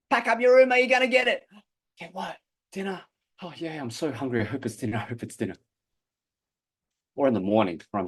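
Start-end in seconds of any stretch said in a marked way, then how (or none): 0.1-1.5 yelling mum. Retold or imitated. Mum threatening. 2.0-5.6 quiet. Retold or imitated. Kid naively hoping for dinner.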